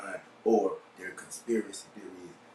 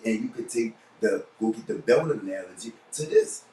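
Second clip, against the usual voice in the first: ordinary talk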